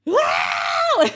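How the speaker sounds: high, loud mock shriek of a scared kid; imitated child's scream. Mother imitating her daughter panicking at the shot news, a high mock shriek. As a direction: scream like a terrified five-year-old.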